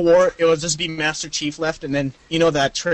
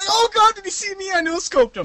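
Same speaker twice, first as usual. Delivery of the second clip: panicked gamer; 14 semitones higher than usual. Retold or imitated. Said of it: "They're like".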